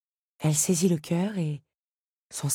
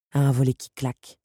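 plain reading voice

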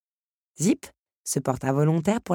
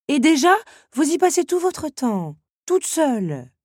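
much higher, louder fussing scold; 11 semitones higher, 7 dB louder than usual. Acted. Teapot scolds Belle for reading alone. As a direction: scold her like a worried mother.

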